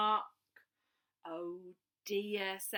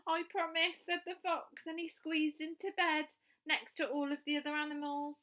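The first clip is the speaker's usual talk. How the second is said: put-on lively read-aloud for children; storytelling; 5 semitones higher, 5 dB louder than usual. A narrator's reading. Storyteller reading a picture book aloud to young children. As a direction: tell the bedtime story with bright expression.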